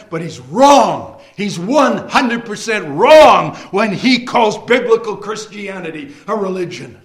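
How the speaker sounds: ordinary talk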